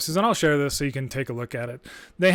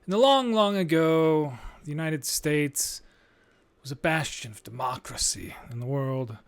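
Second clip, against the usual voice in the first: mock fairy tale; 4 dB quieter than usual. Host mocking the past as a fairy tale, wistful storybook. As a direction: lull them like a bedtime story.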